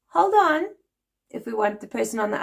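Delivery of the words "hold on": high, loud, abrupt "Hold on"; brisk South African "Hold on". Retold or imitated. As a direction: bark "Hold on" down the phone. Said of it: "in South Africa, we would go".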